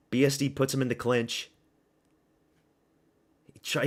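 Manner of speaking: ordinary delivery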